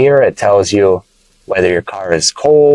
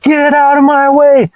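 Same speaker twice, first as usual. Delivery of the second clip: much higher, louder hammed-up yell; mock angry driver; 3 dB louder, slower than usual. Teacher acting a mad driver honking at traffic, hammed-up yell. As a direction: playact road rage, high and loud. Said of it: "You can use it when you're mad".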